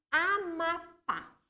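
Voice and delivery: "Amapá" drawn out, syllable by syllable; slow dictation. Shown as a demonstration. Teacher writing an example word on the board, "Amapá" slowly dictated.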